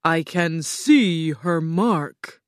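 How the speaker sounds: slow, weighty pronouncement; Oz speaking slowly. A narrator's reading. Oz sees the witch's mark, slow and imposing. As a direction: stretch the words out with grandeur. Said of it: "the Great Oz said slowly".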